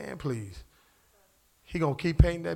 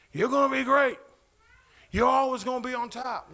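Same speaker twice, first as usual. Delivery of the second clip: mock TV preacher; 7 semitones higher, 3 dB louder than usual. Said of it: "T V preacher tells them".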